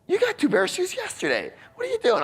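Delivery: fast, very high mock outrage; exasperated husband.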